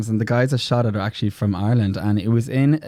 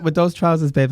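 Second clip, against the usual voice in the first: ordinary talk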